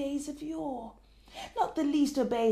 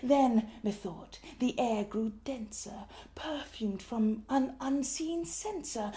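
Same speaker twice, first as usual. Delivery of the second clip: plain reading voice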